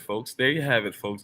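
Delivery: normal talking voice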